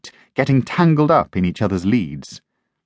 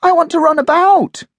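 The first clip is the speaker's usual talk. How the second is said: high, loud, fast protesting puppy; puppy barking that it won't be on a lead; 6 dB louder than usual. Acted. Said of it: "barked Bounce".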